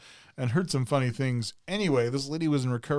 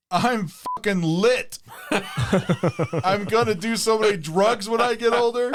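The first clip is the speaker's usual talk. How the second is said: higher, louder, slow and laughing; giddy drugged boy; 9 semitones higher, 5 dB louder, slower than usual. Retold or imitated. Boy waking from ketamine to doctors, giddy. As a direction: whoop it out like a high kid.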